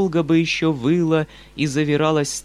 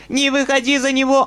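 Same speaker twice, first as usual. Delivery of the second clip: higher and louder plea, peaking on него; old woman repeating her plea in a higher, louder voice; rising plea; 12 semitones higher, 6 dB louder than usual. A narrator's reading. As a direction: push the plea even higher. Old woman begging Nastenka.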